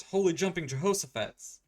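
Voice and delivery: loud, high-pitched exclamation; big cartoonish outburst; mock astonishment. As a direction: cry it out in hammed-up shock. Acted exclamation in retold story.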